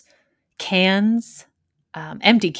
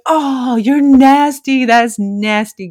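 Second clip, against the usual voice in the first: disgusted teasing; 6 semitones higher, 7 dB louder, much slower than usual. Retold or imitated. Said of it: "group was like".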